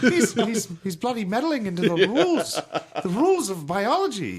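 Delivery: hammed-up British professor voice; mock British Peterson. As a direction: play up a pompous British lecturer, laughing. Peterson impression, laughing.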